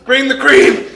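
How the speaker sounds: loud laughing call for cream; laughing shout. Real, not acted. Calling out for cream.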